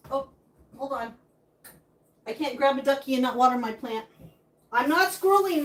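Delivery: ordinary delivery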